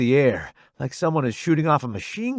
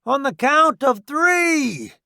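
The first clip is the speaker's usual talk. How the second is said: high, slow raised call; shouted command; 12 semitones higher, 4 dB louder, slower than usual. A narrator's reading. Roddy readying newbie to run, shouted command. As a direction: call out the countdown plan. Soldier readies charge under fire.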